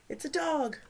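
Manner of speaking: ordinary delivery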